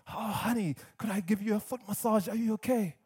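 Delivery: soft, fussing sweet talk; lightly voiced doting boyfriend. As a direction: coo over her like a smitten boyfriend. Doting new boyfriend in joke.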